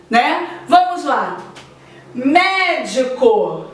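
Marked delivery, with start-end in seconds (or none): 2.1-3.7 Médico said slowly with a long, strong mé; slow teacher's demo of Médico, leaning on mé; stress on MÉ. Shown as a demonstration. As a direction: stretch the first syllable mé of Médico. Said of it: "Mé é a sílaba tônica".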